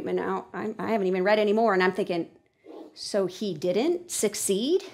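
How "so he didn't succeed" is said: slow, puzzled question, rising on "succeed". Shown as a demonstration. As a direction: end "succeed" on a questioning rise. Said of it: "writing succeed with a question mark".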